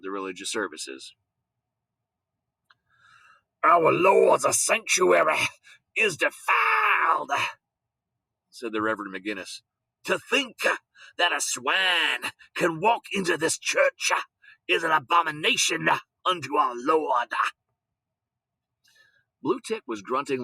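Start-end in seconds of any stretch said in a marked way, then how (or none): 3.6-7.3 outraged preacher. Acted. Preacher outraged at pig in church. 10.0-17.3 outraged preacher. Acted. Preacher condemning swine in church.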